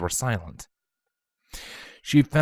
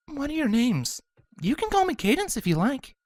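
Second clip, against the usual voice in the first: kind princess. Acted. Cadence speaks kindly.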